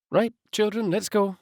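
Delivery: a lightly voiced mother gathering her kids; mum voiced. A narrator's reading. Mother at the door calling her children to leave, brisk. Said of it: "said Mum".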